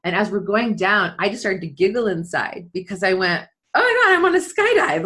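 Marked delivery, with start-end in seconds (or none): 3.7-5.0 much higher and louder, bursting with glee; thrilled realization. Retold or imitated. Realizing mid freefall she is skydiving, thrilled and loud. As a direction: let the joy burst out.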